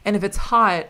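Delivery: usual speaking voice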